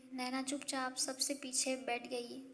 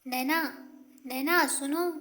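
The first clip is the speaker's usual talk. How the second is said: loud call after her; raised voice calling her name; 9 dB louder than usual. A narrator's reading. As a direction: call her back as she leaves. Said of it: "Radhika ने आवाज़ दी".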